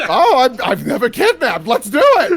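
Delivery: giggling through the line, rising high; laughing. Acted. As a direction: laugh out the words excitedly. Giddy character quote, laughing.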